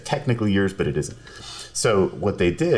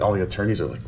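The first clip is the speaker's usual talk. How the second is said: ordinary delivery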